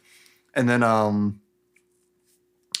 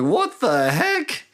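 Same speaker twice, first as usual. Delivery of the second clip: high, drawn-out mock bewilderment; shocked disbelief; 11 semitones higher, much slower than usual. Serena finds soldiers with the king, shocked disbelief. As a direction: act out a baffled "what on earth". Retold reaction to soldiers arriving.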